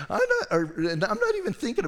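high burst of laughter through the words; laughing. Real, not acted. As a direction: laugh it out at the memory. Laughs at own story.

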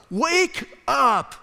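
loud, high cry of wake up; wake-up shout. Retold or imitated. Paul rousing sleeping believers, loud shout. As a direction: shout like shaking someone awake. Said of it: "if he could, he would shake them".

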